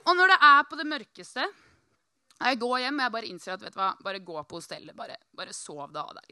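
usual speaking voice

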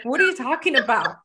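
ordinary talk

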